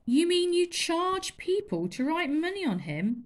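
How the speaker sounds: can't believe it; astonished girl. Acted. Lila astonished.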